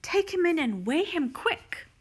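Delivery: high-pitched, urgent command; urgent order. A narrator's reading. Mister Hoppy ordering Missus Silver inside, urgent. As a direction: order it done at once. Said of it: "Mister Hoppy ordered".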